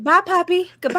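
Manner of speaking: high, breezy goodbye; mock goodbye. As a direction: toss off a careless goodbye. Mock farewell to the puppy.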